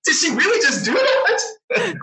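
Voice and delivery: high, laughing incredulity; shocked disbelief. Retold or imitated. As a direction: gasp it out between giggles. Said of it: "looking at each other going like".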